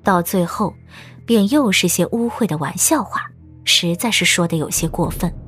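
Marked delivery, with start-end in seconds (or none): none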